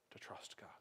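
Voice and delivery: hushed final words; whispered emphasis. Real, not acted. Sermon's closing point.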